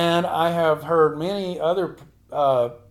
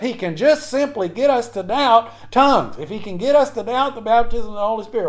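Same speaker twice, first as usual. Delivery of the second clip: driving, insistent pulpit delivery; impassioned preacher building through repetition; fervent preaching; 3 semitones higher, 3 dB louder than usual. Real, not acted. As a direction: hammer out each point in a rising list. Preaches at full force.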